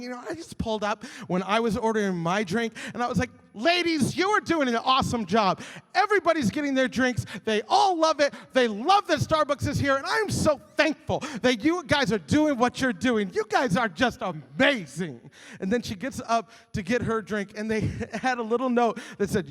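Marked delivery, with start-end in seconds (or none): 3.5-15.2 loud, high, effusive imitation; gushing praise. Wife praising the Starbucks ladies, gushing. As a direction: gush with over-the-top enthusiasm, loud.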